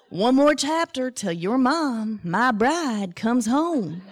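slow, drawn-out Southern drawl; imitating husband's Southern accent. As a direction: stretch it out like a folksy Southern dad. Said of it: "in his Southern accent".

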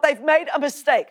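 defensive denial. Retold or imitated. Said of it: "suddenly I went into defense mode".

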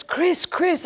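high-pitched mock yell, no real volume; retold yell. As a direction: imitate a friend calling out, pitched high. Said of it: "she yells at me".

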